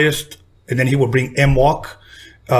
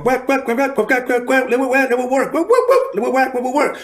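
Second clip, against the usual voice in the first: scratch imitation; 10 semitones higher than usual. Joe cuts up the record on the turntables, mouth-scratch imitation. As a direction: mimic a DJ scratching with the mouth, high. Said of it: "he started, started going".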